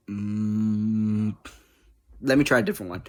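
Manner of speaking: ordinary delivery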